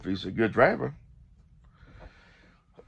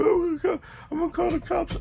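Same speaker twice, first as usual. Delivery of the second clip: mock panicky squeal. Imitating the panicking driver.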